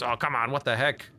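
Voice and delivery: ordinary talk, nothing marked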